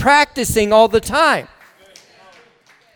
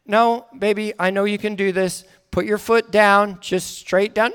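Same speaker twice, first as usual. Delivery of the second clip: soft, steady parental reassurance; coaching; 3 semitones lower, 5 dB quieter than usual. Retold or imitated. Father coaching daughter down the climbing frame, calmly. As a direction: talk the frightened child down quietly. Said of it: "I coach them all the way".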